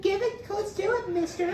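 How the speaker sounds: put-on high, squeaky girl's voice; cocky party girl. Girl at a party challenging him to shotgun, high and cocky. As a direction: taunt him like a tipsy girl.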